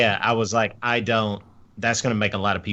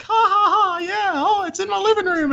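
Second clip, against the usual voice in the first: much higher, forced laughing cheer; fake cheerful, scared; 4 dB louder than usual. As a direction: cover panic with a brittle laugh. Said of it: "scared to death and crying".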